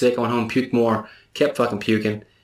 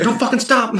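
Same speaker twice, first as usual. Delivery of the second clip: excited yell; 12 semitones higher, 4 dB louder than usual. Retold or imitated. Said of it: "He's like".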